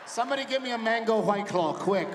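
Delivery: loud, flamboyant demand; camp exclamation. Acted. Dad overcome by his daughter, loud flamboyant demand. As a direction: call out like a diva. Camp aside after the squeal.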